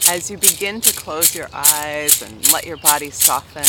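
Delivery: unhurried guided meditation voice; soft gentle meditation. Acted. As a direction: ease them into calm over music. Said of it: "soft, gentle meditation".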